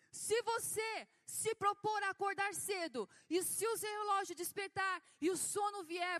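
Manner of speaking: loud, forceful preacher impression; Valnice Milhomes. Apostle commands the congregation to master the flesh, loud imitated preaching. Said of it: "Ela tem a voz assim, bem grave".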